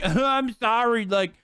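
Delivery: whiny caricature of an offended voter; mock someone acting wounded and defiant; dumb Republican. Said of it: "a dumb Republican who's like".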